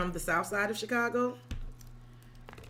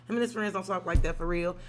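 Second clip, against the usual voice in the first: usual speaking voice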